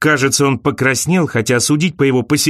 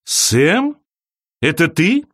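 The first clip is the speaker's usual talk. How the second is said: surprised; 4 semitones higher, slower than usual. A narrator's reading. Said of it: "и удивлённо рассмеялась".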